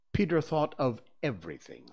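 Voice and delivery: ordinary delivery, nothing marked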